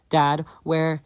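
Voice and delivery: loud, high, urgent call for dad; frantic call. Acted. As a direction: shout it out in panic. Frantic son searching.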